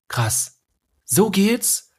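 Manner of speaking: high excited exclamation; excited realisation. Retold or imitated. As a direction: burst out with sudden discovery. Sudden realisation, acted inner voice.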